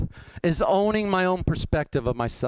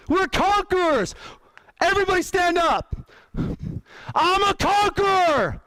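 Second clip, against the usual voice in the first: booming motivational speech; 8 semitones higher, slower than usual. Real, not acted. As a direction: pump the room up. Rally chant, crowd standing.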